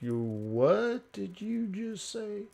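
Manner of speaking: slow, hushed, rising put-on voice; mock offended disbelief. Imitating a local hearing the wrong country, mock disbelief. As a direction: draw it out softly in disbelief.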